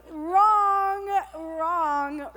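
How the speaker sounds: loud high emphatic denial; emphatic wrong. Real, not acted. Emphatic contradiction of glamour.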